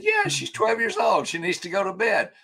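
suddenly high, incredulous protest; exasperated defense. Retold or imitated. To the friend, high incredulous protest. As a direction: squeak up in disbelief, defending yourself.

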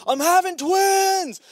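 long, high, very loud holler; panicked yell. Retold or imitated. New dad yelling into the hospital hallway, drawn-out high yell. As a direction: scream the news down a hallway, stretching the words. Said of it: "I yell out".

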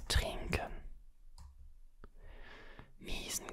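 ordinary delivery

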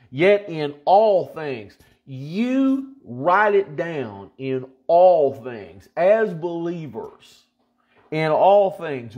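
slow, emphatic preaching on all. Acted. Preacher urging believers to write it down, emphatic. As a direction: preach it, each all landing heavy.

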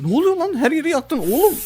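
high, loud burst of outrage; angry outburst. Pretend. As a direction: snap in high-pitched alarm at the mess. Angry reaction to burnt mouth.

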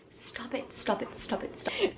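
whispered stop it; hushed repeated pleading. Real, not acted.

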